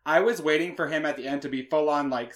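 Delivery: ordinary delivery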